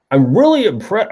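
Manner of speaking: mock teacher praising an essay; teacher's praise. Recalling teacher's written comment, mock-impressed voice. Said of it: "I can still hear his voice".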